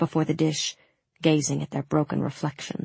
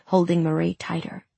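ordinary delivery, nothing marked

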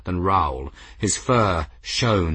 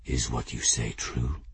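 very low, hushed cat growl; deep, quiet growled question; 9 semitones lower, 9 dB quieter than usual. A narrator's reading. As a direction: drop to a soft growl for one listener's ears alone. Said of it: "his voice dropped to a low, low growl".

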